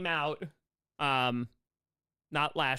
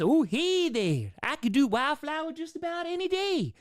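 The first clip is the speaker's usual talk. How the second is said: a sweet, airy hello in Wildflower's voice; light, higher-pitched friendly character greeting; 9 semitones higher than usual. Shown as a demonstration. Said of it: "Wildflower is easy".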